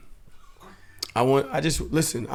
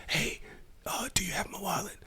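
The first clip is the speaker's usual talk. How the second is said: whisper; 7 semitones higher, 11 dB quieter than usual. Retold or imitated. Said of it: "So I'm just like".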